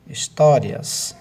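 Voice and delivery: histórias drawn out slowly, the syllable tó leaned on; histórias stretched, tó held; stressed syllable. Shown as a demonstration. Teacher reads the example slowly, histórias with tó stressed. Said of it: "teacher stretches example word".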